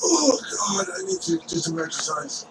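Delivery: gasping, soft and breathless; winded. Acted. Winded priest catching up after the chase, gasping for air. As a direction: huff it out, fading away. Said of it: "he's, like, winded".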